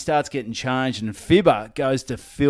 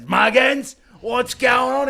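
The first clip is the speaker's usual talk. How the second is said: loud, high call of the name; confronting coach; 13 semitones higher, 6 dB louder, slower than usual. Retold or imitated. Coach confronting Muggins in the shed, loud call. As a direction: bark the question at him.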